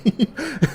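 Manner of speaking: quick high burst of laughter; amused chuckle; short real laugh. Real, not acted. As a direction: laugh at your own story. Laughing at own story.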